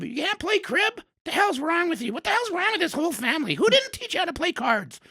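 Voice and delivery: granny aghast nobody taught him cards; scandalized grandma. Retold or imitated. Grandma scolding, can't play crib.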